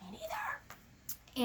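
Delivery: whispered aside. Real, not acted. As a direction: whisper it under breath. Co-host whispers agreement aside.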